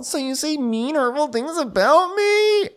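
very high-pitched wounded protest; hammed-up high hurt voice; mock hurt whine. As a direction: squeal the accusation, drawing out the end. Acted quarrel, [name] to Kyle.

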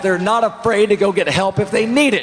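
high impassioned shout. Real, not acted. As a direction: rant it at the crowd. Candidate shouting his speech.

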